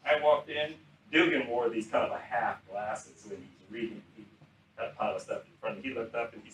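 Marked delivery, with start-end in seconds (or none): none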